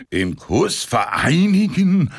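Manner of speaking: high, slow, fidgety disbelief; jittery excited "Im Kuss vereinigen?"; impatient eager question. Acted. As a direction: ask it twitching with impatience. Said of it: "Semjonitsch zappelte vor Ungeduld".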